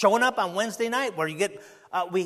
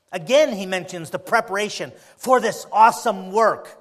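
usual speaking voice

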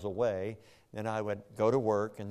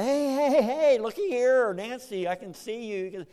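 high, loud call to get her attention; 14 semitones higher, 10 dB louder than usual. Retold or imitated. Husband calling loudly to his waking wife.

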